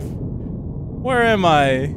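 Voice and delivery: usual speaking voice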